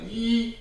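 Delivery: "E" held long and high as eee; long E sound. Shown as a demonstration. Teacher showing the Y sound, long high E. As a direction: sing out "E" as a high, stretched ee. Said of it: "the Y was pronounced E".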